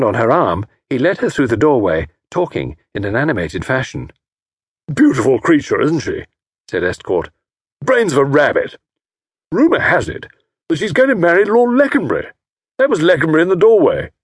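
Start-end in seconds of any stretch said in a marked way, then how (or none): 4.9-14.1 loud, high, jovial teasing; hearty old fellow. A narrator's reading. Jovial host teasing Tommy about his wife, hearty. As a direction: slap him on the back with it. Estcourt gossiping.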